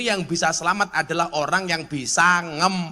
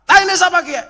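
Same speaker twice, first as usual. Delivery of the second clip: loud, higher ngapak yell, outraged; retold shout; 11 semitones higher, 6 dB louder than usual. Ngapak visitor shouting about the dung underfoot, loud yell. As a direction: bellow it like a man who just stepped in it. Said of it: "orang ngapak".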